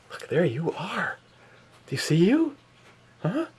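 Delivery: high sing-song dog talk. Real, not acted. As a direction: coo at the dog, high and bright. Showing dog its reflection.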